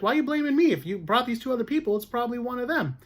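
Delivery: high, fast, exasperated retelling; exasperated paraphrase. Retold or imitated. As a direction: spell out her frustration in a rush. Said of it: "she just means, like".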